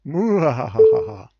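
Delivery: loud, slow villain laugh; evil vampire cackle. Acted. Prank caller gloats at the scammer, loud vampire cackle. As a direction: cackle loudly like a hammed-up vampire.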